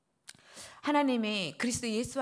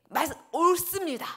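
higher, defiant retort; defiant talking back; 7 semitones higher, 3 dB louder than usual. Retold or imitated. Jonah answering God back, defiant and raised. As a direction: snap back at God stubbornly. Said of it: "막 이렇게 또 대들어요".

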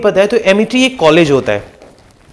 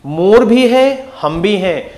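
slow memory line; slower than usual. Shown as a demonstration. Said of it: "याद करना है ultimately".

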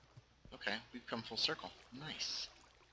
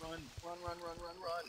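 panicked; 9 semitones higher, 8 dB quieter than usual. Real, not acted. Fleeing creepers.

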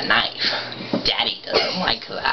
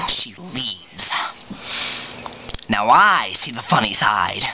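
creepy villain telling a scar story in halting pieces; Joker; 2 semitones lower, 3 dB quieter than usual. Acted. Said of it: "do your, uh, Joker impression".

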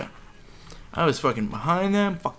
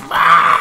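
strenuous scream; 3 semitones higher, 10 dB louder than usual. Acted. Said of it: "like an ogre screaming".

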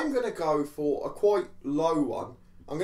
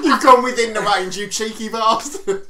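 laughing; 6 semitones higher, 8 dB louder, slower than usual. Real, not acted. Laughing at friend's guess.

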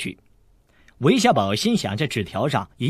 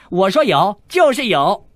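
much higher, loud triumphant declaration; shouting triumphantly, much higher; loud insistence; 7 semitones higher, 7 dB louder than usual. Acted. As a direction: declare it as he grabs them. Boastful insistence.

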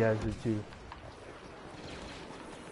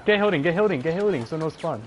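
hyped gamer bro; 4 semitones higher, 5 dB louder, faster than usual. Real, not acted. Calling out to team.

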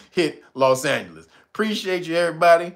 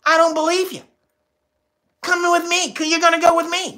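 high, put-on cop voice; mock cop voice; 13 semitones higher, 4 dB louder than usual. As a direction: play the suspicious officer, high and pushy. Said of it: "they come up to you and they say".

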